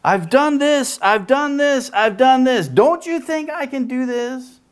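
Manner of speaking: exasperated God, pitched high. Retold or imitated. God in heaven to us, exasperated and pleading. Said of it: "God's just sitting there in heaven going".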